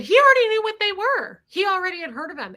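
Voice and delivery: high, loud defensive comeback; exasperated retort. Retold or imitated. Teller replays defending herself to Jeff, high and exasperated. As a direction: push back hard, then rush on. Acted retort in a car quarrel.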